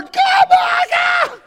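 very high, loud cry; panicked shriek. Retold or imitated. Husband watching the birth, panicked high shriek. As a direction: shriek it out in horror.

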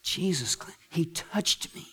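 very soft, halting testimony; almost whisper it in wonder; hushed awe. Acted. Healed leper testifies in awe.

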